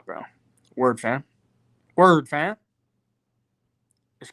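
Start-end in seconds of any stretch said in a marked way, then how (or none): 2.0-2.5 hammed-up street greeting, punched and louder; louder mock Toronto slang. Streamer answering chat, hamming up Toronto slang louder. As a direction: repeat it louder, playing up the slang.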